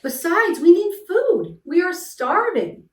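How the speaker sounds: high, strained pleading; stretched, high-pitched protest; groaned complaint. A narrator's reading. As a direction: plead desperately with the king. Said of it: "groaned Mac".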